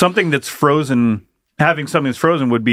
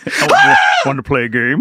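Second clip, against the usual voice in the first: Saw killer voice. Pretend. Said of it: "Movie Saw would be".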